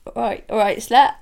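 put-on loud, high, matey Bristol accent; affectionate Bristol accent banter between mates. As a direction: shout it chummily in a Bristol accent. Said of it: "You can't take a slur in a Bristol accent".

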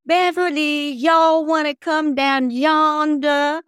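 high, loud, slow mocking Southern drawl; mocking Southern accent. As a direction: taunt her like the kids outside the window, in a thick Southern drawl.

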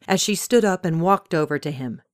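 ordinary delivery, nothing marked

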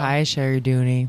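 slow, sultry sexy voice. Shown as a demonstration. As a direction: purr it in a sexy voice. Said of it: "Say it in your sexy voice".

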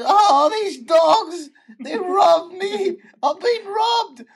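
high, slow hammed-up wail; crying. Same passenger repeats her sob story to cabbie, crying. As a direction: drag out each phrase in a high mock bawl.

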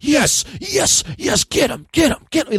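high-pitched mock-ecstatic cry; mock orgasmic glee. Villain watching heroes get attacked on screens, mock orgasmic glee. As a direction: imitate a villain getting off on it. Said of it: "he's orgasming thinking about".

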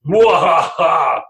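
slow hammed-up villain cackle; mock evil mwahaha; evil laugh. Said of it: "comes out and goes".

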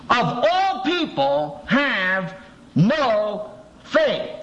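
disappointed teacher drawing it out; slow rebuke. Retold or imitated. Jesus rebukes the disciples for no faith, slow and high.

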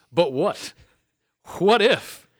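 high, loud, teasing build-up; dramatic proposal. Pretend. Player pitching a game-breaking combo to co-hosts, theatrical. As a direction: pose it like a grand reveal, pausing between phrases.

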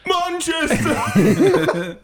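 high, long-drawn squawk in fake English accent; hammed-up English accent, stretched out, laughing; bad English accent. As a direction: hoot it in a mock-English accent. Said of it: "your English accent".

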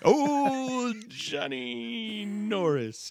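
high, slow swooning delivery; dramatic drawl. Pretend. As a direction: stretch the name out dreamily. Dramatic drawn-out name.

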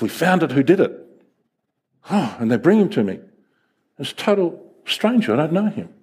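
ordinary delivery

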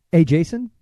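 normal talking voice

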